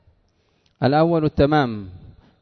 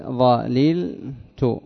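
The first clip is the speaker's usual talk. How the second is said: "ظللت" very slowly, each syllable held apart; much slower than usual. Shown as a demonstration. Teacher undoing the doubled letter for students, syllable by syllable. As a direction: stretch "ظللت" out in separate parts. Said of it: "تفك الإدغام".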